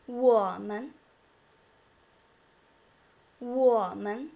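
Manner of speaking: "我们" low dipping 我, light 们; light neutral tone. Shown as a demonstration. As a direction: dip 我 deep, then a light 们 lifted after it. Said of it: "make a neutral tone very light".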